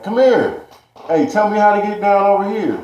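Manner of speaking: mock friendly stranger voice; creepy stranger calling. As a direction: mimic the creep waving a kid over. Stranger calling him over.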